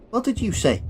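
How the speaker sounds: put-on high-pitched, affronted Liverpool accent. Billy questioning the Colonel, affronted Liverpool accent. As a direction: take offence in a Liverpool accent. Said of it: "That explains the accent".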